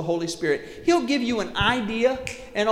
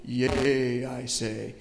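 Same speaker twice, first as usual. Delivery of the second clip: lower, archaic prophetic intonation; a hint of a mock-solemn prophet; King James prophet; 8 semitones lower, slower than usual. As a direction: intone it like old scripture. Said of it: "it's not gonna be a".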